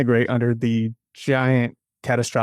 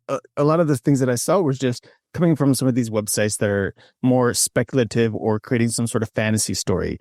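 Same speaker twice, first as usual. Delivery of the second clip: ordinary talk, nothing marked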